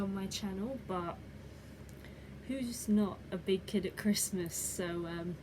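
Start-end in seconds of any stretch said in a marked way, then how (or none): none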